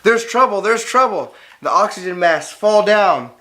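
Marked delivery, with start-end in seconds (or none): 0.0-1.3 raised, alarmed warning on the plane; mock panic, voice jumping higher; alarm call. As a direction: sound the alarm, pitched up.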